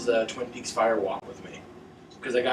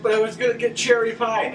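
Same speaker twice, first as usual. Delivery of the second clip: put-on whiny kid; 9 semitones higher, 4 dB louder than usual. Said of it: "And then I said".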